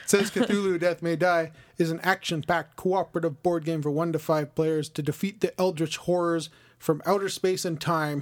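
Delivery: usual speaking voice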